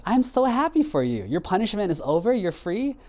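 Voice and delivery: high-pitched, acted happy astonishment; storyteller's high, thrilled rich man; delighted surprise. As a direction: rejoice for someone, pitch leaping up into questions. Rich man rejoices for freed goat.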